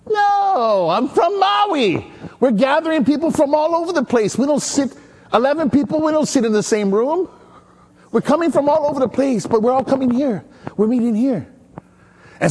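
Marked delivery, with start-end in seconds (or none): 0.1-11.5 much higher, slowed right down on "I'm from Maui"; protest, higher and exasperated; indignant reply. Retold or imitated. Preacher retelling his protest to the hostess, higher and exasperated.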